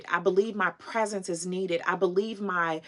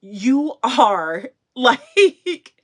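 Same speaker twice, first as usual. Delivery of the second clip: emotional fan; 3 semitones higher, 7 dB louder, slower than usual. Retold or imitated. Retelling fan's emotional words.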